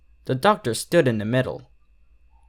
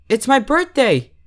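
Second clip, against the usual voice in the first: much higher and louder, squealing with joy; excited squeak; 12 semitones higher, 5 dB louder than usual. Acted. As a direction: squeak it out, suddenly wide awake. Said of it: "she squeaked".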